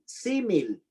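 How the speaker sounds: símil with the stress on the first I, SÍ-mil; a teacher showing where the accent falls in símil. Shown as a demonstration. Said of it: "acento en la primera I".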